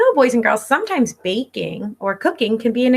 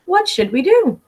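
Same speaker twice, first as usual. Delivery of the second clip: bright, higher storybook character voice; eager kid character; 8 semitones higher, 3 dB louder than usual. Acted. Teacher voicing a classmate asking the group, high and eager. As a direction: ask it like an excited little kid.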